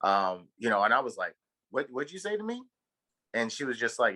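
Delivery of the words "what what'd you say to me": indignant disbelief. Retold or imitated. Retold confrontation with ex-wife.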